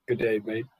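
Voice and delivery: lightly put-on Australian accent; a hammy, slight Aussie twang. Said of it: "awful accent".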